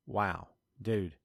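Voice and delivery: ordinary talk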